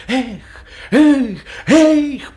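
high, loud whoops to the horses; coachman's cries. A narrator's reading. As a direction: call out to urge the horses on. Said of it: "Селифан только помахивал да покрикивал". (громкое покрикивание кучера на лошадей)

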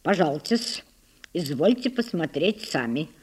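low-voiced, noncommittal servant's reply; evasive. A narrator's reading. Servant dodges the sisters' question, evasive. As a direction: answer evasively, keeping it low. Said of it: "уклончиво ответил Лука".

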